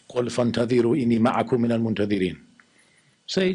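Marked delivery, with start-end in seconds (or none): 0.1-2.4 Quran recitation. Acted. Quotes a Quran verse.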